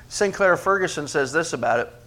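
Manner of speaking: usual speaking voice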